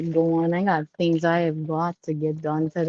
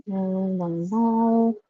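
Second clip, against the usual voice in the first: normal talking voice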